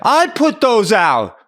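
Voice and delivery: slow, high, loud retort; shouting back. Retold or imitated. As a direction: imitate an angry stranger hollering back. Stranger shouting back across beach.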